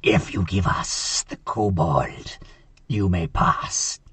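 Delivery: low, slow goblin voice; low menacing creature drawl, slowly; goblin voice from the trees. Acted. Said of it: "in Goblin and goes".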